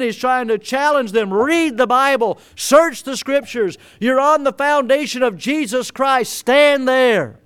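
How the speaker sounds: fiery preaching. Real, not acted. Pastor urging the church to stand firm, fervent. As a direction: drive it home to the congregation.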